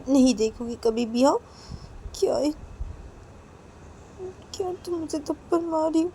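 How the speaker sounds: Neha's meek pleading. Acted. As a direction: deny it, then beg not to be hit. (सहमी हुई मिन्नत)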